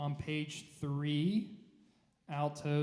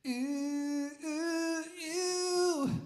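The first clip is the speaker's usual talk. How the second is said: high, rising sung oohs, the way not to do it; flat, spread ooh sung high; wrong ooh; 4 dB louder, much slower than usual. Shown as a demonstration. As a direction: sing ooh climbing higher and higher. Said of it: "We're not doing like that".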